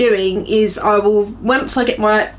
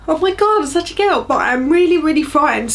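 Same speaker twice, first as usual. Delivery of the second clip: frightened; 5 semitones higher than usual. Real, not acted. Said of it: "I am so frightened".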